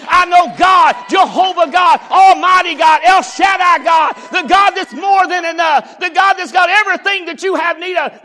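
loud, high building roar of God's names; preaching at full force. Acted. Preacher shouting God's names to the congregation.

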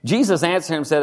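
normal talking voice

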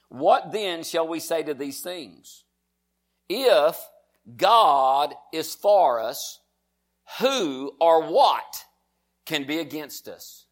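very slow Bible reading with weighty pauses; deliberate, emphatic pulpit reading; declaimed scripture. Acted. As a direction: let each phrase land before the next.

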